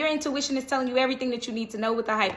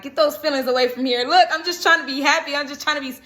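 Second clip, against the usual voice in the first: a light voicing of an annoyed king shooing emotions, rising louder; mock king pushing feelings away; 4 semitones higher, 5 dB louder than usual. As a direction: brush the feelings off like a cranky king. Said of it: "it looks like he's zapping something".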